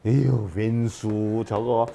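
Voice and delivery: slow, sighing grumble; weary sigh. Acted. Wife sighing about her nagging husband, weary grumble. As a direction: drag it out like a fed-up wife.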